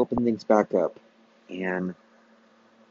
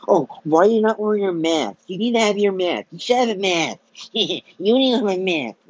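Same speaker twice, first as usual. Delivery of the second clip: high, whiny nagging mockery; mocking troll voice; 7 semitones higher than usual. Vlogger mocking a shopper who nags about masks, high whiny mimicry. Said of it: "some idiot troll says".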